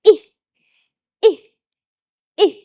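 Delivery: Eh as short i like itchy; short I sound. Shown as a demonstration. Teacher acting out brushing off an insect, short i. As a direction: flick it off with a quick short i for Eh. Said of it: "shrug it off and go".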